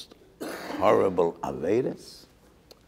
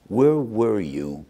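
slow rebuke; 3 semitones higher, 5 dB louder, much slower than usual. Acted. Heavenly rebuke of the students.

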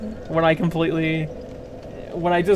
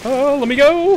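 struggling shout; 9 semitones higher, 4 dB louder than usual. Real, not acted. Grabbed by enemy.

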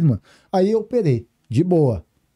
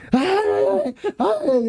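pain cry; much slower than usual. Retold or imitated. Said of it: "Você parecia um louco gritando".

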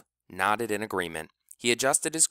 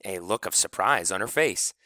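plain reading voice

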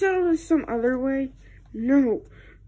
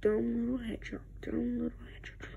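soft, fast grumbling under the breath; hushed, quick name-calling; muttered insult; 2 semitones lower, 10 dB quieter, faster than usual. Acted. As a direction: mutter it sulkily, barely voiced. Grumbling insults after the quarrel.

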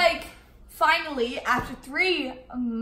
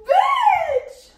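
no building to a high laughing shriek; laughing scream; 7 semitones higher, 7 dB louder than usual. Real, not acted. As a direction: panic as it spills, laughing. Chocolate spills, laughing scream.

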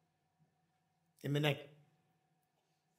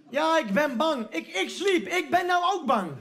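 mock scared wife; 6 semitones higher, 3 dB louder than usual. Wife voiced afraid in story.

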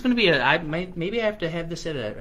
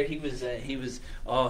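ordinary delivery, nothing marked

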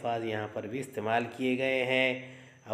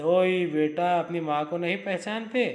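startled, louder cry of disbelief; a mother shocked her son doesn't know her; surprised mother; 5 semitones higher, 4 dB louder than usual. Acted. Mother, astonished her son won't recognise her, calls out. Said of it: "माँ कहती है हैरत से".

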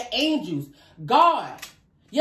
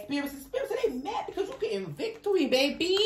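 laughing; 4 semitones higher, 7 dB quieter, faster than usual. Real, not acted. Laughing while reading cards.

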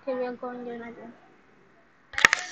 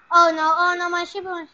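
panicked oh no; 4 semitones higher, 11 dB louder, faster than usual. Real, not acted. Ship being destroyed.

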